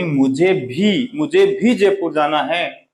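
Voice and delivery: very slow, "मुझे भी" leaned on twice; stress on मुझे भी. Shown as a demonstration. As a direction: insist with "मुझे भी, मुझे भी". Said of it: "बात को बल देने के लिए".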